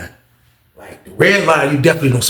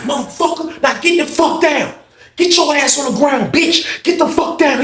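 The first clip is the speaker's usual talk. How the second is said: very high-pitched imitation of barked orders; acted angry command; 13 semitones higher than usual. Officer ordering the inmate to the ground, high angry commands. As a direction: order him down, voice shot way up.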